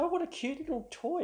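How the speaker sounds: high, sing-song cooing over a toy; mock cutesy teasing. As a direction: coo at it like it's a child's plaything. Mocking friend's new ukulele.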